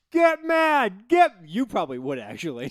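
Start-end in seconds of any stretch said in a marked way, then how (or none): 0.1-1.3 high, loud taunt; raised mock jeer; mimicked heckler shout. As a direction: yell it like a heckler at a game. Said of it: "some guy was like".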